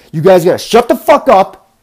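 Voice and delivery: angry yelling at the passengers; retold blow-up. Driver yelling at chattering passengers, furious. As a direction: snap at them to shut up, voice climbing high and loud. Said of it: "And I just blew up".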